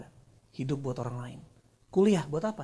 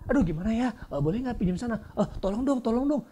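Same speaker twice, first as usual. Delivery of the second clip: higher-pitched acted begging; mock pleading; 8 semitones higher, 4 dB louder than usual. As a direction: put on a helpless plea for a favour. Voices someone begging for help.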